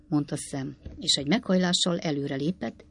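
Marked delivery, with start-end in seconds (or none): none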